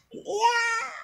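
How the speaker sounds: sharp, very high battle cry; karate yell. Shown as a demonstration. Vlogger demonstrating a karate-style shout to stop hiccups, very high cry. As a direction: let out a karate kiai. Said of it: "The max ang sigaw mo".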